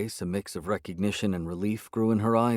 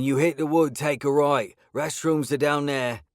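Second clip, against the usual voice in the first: higher, louder, calling directions after someone; 6 semitones higher, 3 dB louder than usual. A narrator's reading. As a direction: shout the directions to a runner. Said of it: "Benny called out".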